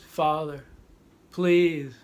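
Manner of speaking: high, loud anguished cry for his father; desperate plea. Retold or imitated. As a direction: beg in agony, voice breaking high. Luke begs while being electrocuted.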